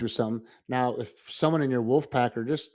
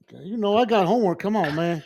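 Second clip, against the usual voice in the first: mock complaint, higher and louder; joking protest; 8 semitones higher, 5 dB louder than usual. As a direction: protest playfully, pitched up. Joking protest about homework.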